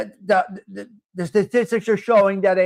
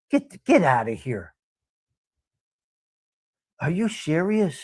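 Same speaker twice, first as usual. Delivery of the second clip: hushed surprised phone gossip; 4 semitones lower than usual. Retold or imitated.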